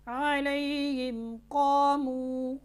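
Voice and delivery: slow chanted tajweed recitation; Quran recitation. Acted. Teacher recites the verse for students, slow and chanted. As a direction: intone the verse slowly, melodically.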